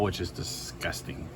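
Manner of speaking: whispered disgust. Real, not acted. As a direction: breathe it out in disgust. Disgust at kale.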